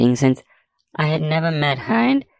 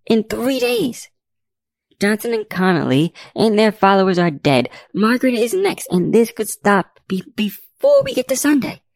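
high-pitched, urgent pleading; 7 semitones higher than usual. A narrator's reading. Investigator begging Agent Sparks to act on the evidence, pleading. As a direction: plead the case with rising pitch. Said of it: "I pleaded with him".

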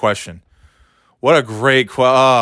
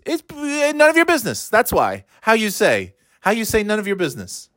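much higher mock Spanish accent; fake Spanish accent; 10 semitones higher than usual. Comic mocking the wife's fake Spanish accent, high. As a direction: grope for words like a fake Spanish speaker. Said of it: "With no accent".

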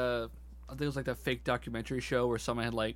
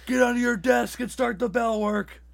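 high, loud barking teacher voice; bossy teacher; 11 semitones higher, 8 dB louder than usual. Retold or imitated. Mock teacher commanding students, loud high bark. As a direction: order the class to get to work.

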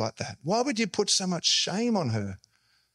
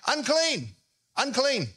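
mock yell; 4 semitones higher, slower than usual. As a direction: call out a warning to passers-by. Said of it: "she has to yell out every day".

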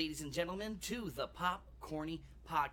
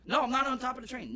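mock indignant; 8 semitones higher, 6 dB louder, faster than usual. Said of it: "quoting a person who mishears".

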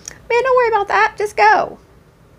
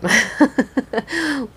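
short, higher-pitched laugh; helpless laugh; 6 semitones higher than usual. Real, not acted. Podcast host stumped by a listener's accent dilemma, laughing. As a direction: break into a brief laugh mid-thought.

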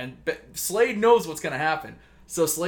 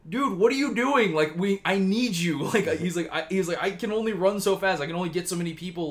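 high, raised imitation of a desperate plea; retold scream; 5 semitones higher, 3 dB louder than usual. Barry begs Oliver for help over comms, mock screaming. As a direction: call out urgently, pitched up, easing off. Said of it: "he hears Barry screaming over comms".

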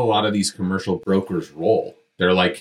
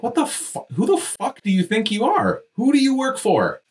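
outraged disbelief. Retold or imitated. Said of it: "And I'm like".

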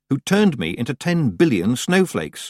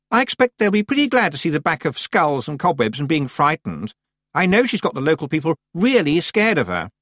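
higher-pitched, self-important chatter; haughty Anagramma, confident; 7 semitones higher than usual. A narrator's reading. Anagramma on the local people and Miss Treason, haughty. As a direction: talk confidently in a high, superior voice.

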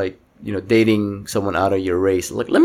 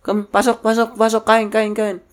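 welcoming Filipino host voice; 12 semitones higher than usual. Retold or imitated. Imitating welcoming Filipino relative.

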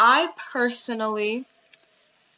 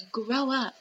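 ordinary delivery

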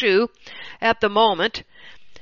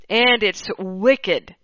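ordinary talk, nothing marked

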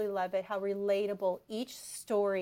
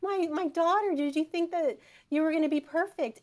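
tender Jesus, higher, with a laugh; quoting Jesus; 8 semitones higher, 6 dB louder than usual. Retold or imitated. Mom retelling Jesus to Mary, higher and tender. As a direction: voice Jesus kindly teasing his daughter. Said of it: "he looks right at Mary and says".

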